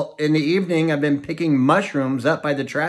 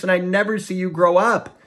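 sobbing; 6 semitones higher than usual. A narrator's reading.

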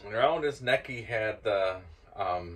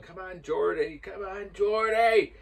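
higher-pitched cheering call, building louder; imitated crowd yelling; 7 semitones higher, 3 dB louder than usual. Storyteller recalls fans cheering the Scotsman on, mock yelling. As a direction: mimic fans egging on their mate. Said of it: "I swear they're yelling".

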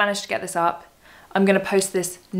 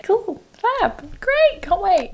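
squeaky sarcastic; 13 semitones higher, much slower than usual. Pretend. Sarcastic sign-off.

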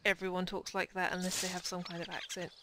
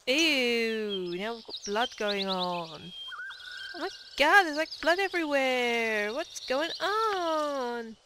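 put-on Valley Girl; much slower than usual. Gamer reacting to blood on screen as a mock Valley Girl. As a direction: play a shocked mall girl. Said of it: "got a bit of Valley Girl".

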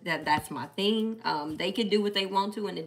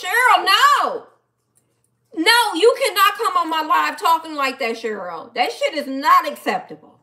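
louder and higher, scolding a friend; playful scolding; 6 semitones higher, 6 dB louder than usual. Real, not acted. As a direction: call her out, half-joking. Scolding a commenter on live.

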